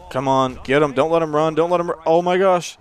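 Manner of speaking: high, loud, building urgency; urgent exhorting. Real, not acted. Gamer pleads with his defense to stop the runner, urgent. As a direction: urge the defense on, frantic. Urging defense during a play.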